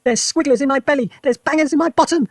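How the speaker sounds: put-on high, loud outraged old woman; indignant grandma yelling high; yelled. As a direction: shriek the question back. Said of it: "she yelled".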